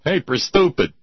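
mock childish taunt. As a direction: call it out like a schoolyard tease. Imagined childhood taunt of the chief.

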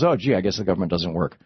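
low, resigned "oh, gee" voice; mock gullible public. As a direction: give in like a duped bystander. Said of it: "And everybody goes".

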